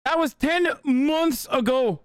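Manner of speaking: high, slow mock Irish lament; Irish accent. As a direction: stretch it out in a hammed-up Irish accent. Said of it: "I haven't stopped doing the accent".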